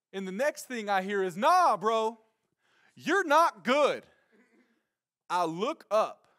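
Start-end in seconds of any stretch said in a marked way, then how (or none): 1.4-4.0 loud, high, drawn-out holler; called-out warning. Retold or imitated. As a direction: shout the bad news across water. Retells friend calling from afar.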